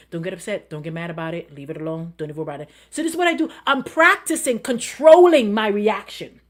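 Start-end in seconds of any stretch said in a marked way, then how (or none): 0.1-2.7 softly, talking herself down; quiet self-talk. Shown as a demonstration. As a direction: murmur it to yourself to stay calm. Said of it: "I say to myself".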